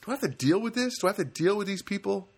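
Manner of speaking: high-pitched exasperated grumble; irritated. Retold or imitated. Shopper facing kids at both entrances, acted irritation. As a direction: retell it with peeved disbelief. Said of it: "I'm getting irritated".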